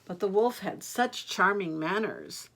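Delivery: ordinary delivery, nothing marked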